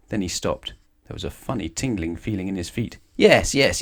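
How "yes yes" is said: higher, hasty yes; hurried. Acted. Mister Mean hurrying to agree as his feet tingle, hurried. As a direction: rush it out. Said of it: "he said hurriedly".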